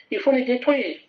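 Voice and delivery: ordinary delivery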